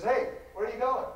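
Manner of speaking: a librarian calling after a stray visitor. Retold or imitated. Librarian calls after him.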